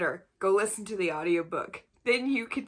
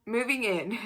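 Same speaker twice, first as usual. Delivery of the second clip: suppressed laughter; 4 semitones higher, 4 dB louder than usual. Real, not acted. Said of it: "still laughing about the last one".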